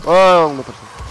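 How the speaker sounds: loud, urgent exclamation; loud exclaim. Acted. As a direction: call it out in a rush. Rushing, repeats it louder.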